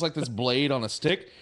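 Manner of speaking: normal talking voice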